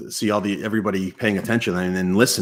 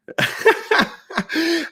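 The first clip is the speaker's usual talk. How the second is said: real burst of laughter. Real, not acted. Laughs at mint comment.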